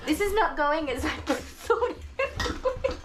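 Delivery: slow, high words through real laughter; laughing. Real, not acted. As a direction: giggle helplessly at the puppy. Laughing at the wild dog.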